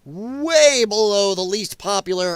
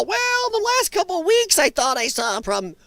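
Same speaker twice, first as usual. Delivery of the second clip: mock her in a much higher, prissy pitch; 10 semitones higher than usual. Host mimicking the ex-press secretary's book excuse, high and mocking. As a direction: squeak it out like a mincing excuse-maker.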